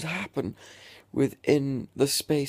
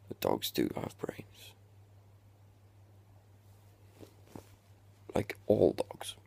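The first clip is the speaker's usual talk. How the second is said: whispered like a big secret; mock secret whisper; 9 semitones lower, 6 dB quieter than usual. Telling viewers a mock secret, whispered. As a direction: hush it as a solemn reveal.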